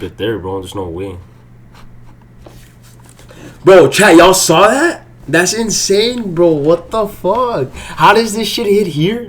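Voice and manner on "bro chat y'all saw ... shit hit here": high, loud and stretched out in disbelief; outraged disbelief. Real, not acted. Streamer asking chat if they saw the miss, outraged. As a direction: demand the chat see this injustice. Shows chat the missed hit.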